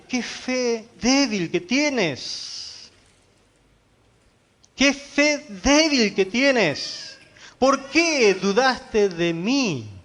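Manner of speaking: very slow, emphatic reproach; preacher's retold rebuke, words drawn out slowly; acted rebuke. The Lord to doubting Pedro, acted slow rebuke.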